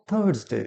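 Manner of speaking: থার্সডে with silent r, softly modelled; corrected Thursday. Shown as a demonstration. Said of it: "এই আর এর উচ্চারণটা silent থাকে". (থার্সডে-র সঠিক উচ্চারণ, র নীরব)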